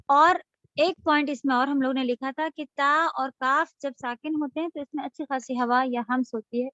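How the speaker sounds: usual speaking voice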